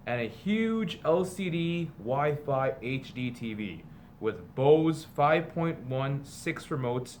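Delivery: slow, sing-song picture book read-aloud; storybook reading. A narrator's reading. Teacher reading a parody picture book to students, storybook voice. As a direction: read it like a bedtime story to the class.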